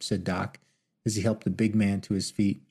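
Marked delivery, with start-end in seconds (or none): none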